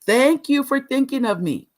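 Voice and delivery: pitched high, each phrase set out for all to hear; for the back row. Shown as a demonstration. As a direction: deliver it to the folks in the back. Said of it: "for the folks in the back".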